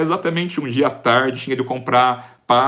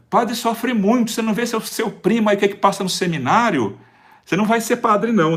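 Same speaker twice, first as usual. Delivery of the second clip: higher, lightly voiced scolding mother; imitating his mother; 8 semitones higher than usual. Mother brushing off her son's wish, imitated higher. Mother warning son off priesthood.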